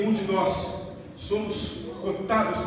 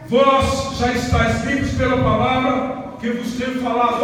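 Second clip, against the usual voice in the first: preaching scripture; 4 semitones higher, 6 dB louder than usual. Acted. Scripture read with force.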